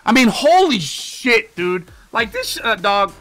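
high, loud, stretched-out disbelief; disbelieving high yell. Real, not acted. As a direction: cry out in disbelief. Disbelief at a failing offense.

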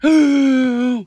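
big sharp gasp of shock, loud; dramatic gasp. Acted. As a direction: gasp as if the picture truly shocks you. Dramatic picture book reaction.

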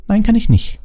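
high, cross refusal; sharp, high-pitched rebuff; snapping. A narrator's reading. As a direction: snap it in a high girl voice. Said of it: "fauchte Hermine".

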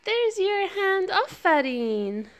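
sing-song picture book questions. Real, not acted. Parent reads picture book to toddler.

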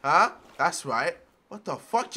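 ordinary talk

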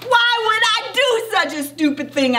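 high-pitched indignant demand; indignant kid's outburst. A narrator's reading. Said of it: "I demanded to know".

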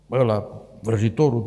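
ordinary delivery

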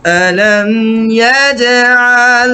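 slow melodic tajweed chant; Quran recitation. Shown as a demonstration. Model Quran recitation with music. (ধীর সুরেলা কুরআন তেলাওয়াত)

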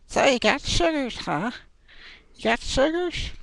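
very high-pitched mock old lady voice. Old lady asking about his diabetes, high put-on voice. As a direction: squeak it high like a prying old woman. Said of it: "an old lady coming to me and say".

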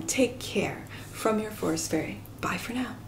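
soft breathy farewell; whispered goodbye. Real, not acted. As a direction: whisper the sign-off gently. Soft sign-off as forest fairy.

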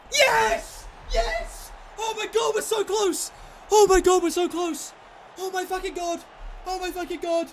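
high-pitched elated cries; real overexcited celebrating; thrilled disbelief. Real, not acted. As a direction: cry out as victory gets close. One kick from winning.

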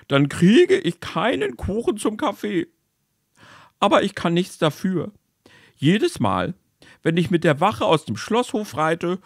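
high whiny soldier voice, close to tears; sobbing soldier. Acted. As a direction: whimper it like a tearful soldier. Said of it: "schluchzte der Soldat".

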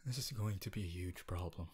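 whispered aside of dismay; worried whisper. Acted. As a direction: mutter the worry under your breath. Despairs over classmate's inattention.

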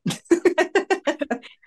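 high, loud giddy laugh; blissful laugh. Real, not acted. Woman laughing blissfully after her sister's meditation. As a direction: burst out laughing with joy.

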